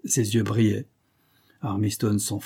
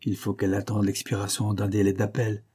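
plain reading voice

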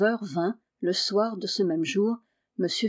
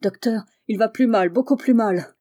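louder, urgent plea of a frightened wife; wife rushing in, distressed, louder and urgent; deeply distressed; 2 semitones higher, 5 dB louder than usual. A narrator's reading. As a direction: press the bad news out in alarm. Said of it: "en proie à la plus vive émotion".

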